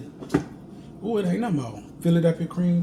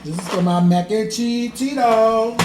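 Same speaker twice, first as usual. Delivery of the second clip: excited announce; 6 semitones higher, 8 dB louder than usual. Real, not acted.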